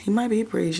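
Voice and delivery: normal talking voice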